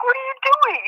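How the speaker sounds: very high-pitched retold disbelief; aghast. Uncle to nephew straddling the border, aghast. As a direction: question him in mock horror.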